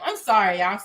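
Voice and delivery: ordinary delivery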